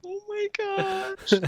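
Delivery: high, slow, stunned disbelief; stunned oh my gosh. Real, not acted. Friend reacts to a ridiculous book blurb, stunned. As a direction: gasp it out in disbelief.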